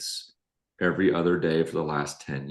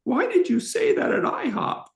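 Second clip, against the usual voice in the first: much higher, hurt, quoting the crying wife; high tearful wife voice. Retold or imitated. Husband retells his crying wife's question in a high voice. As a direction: squeak it out like his teary wife. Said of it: "she said".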